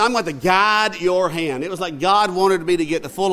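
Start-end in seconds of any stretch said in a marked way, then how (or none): 0.0-1.6 slow, raised, louder instruction; deliberate, word-by-word boy's quote; imitated child teacher. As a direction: spell it out slowly as a small boy teaching. Boy offers to teach the man.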